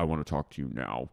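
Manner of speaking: low, quick command; mock menacing demand. [name] demanding a talk, low mock menace.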